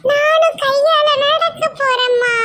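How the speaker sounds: put-on very high, drawn-out kid voice; excited child. Child tells mother they will join, piping up. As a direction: pipe up to mum like a child.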